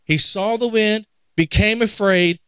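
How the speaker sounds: usual speaking voice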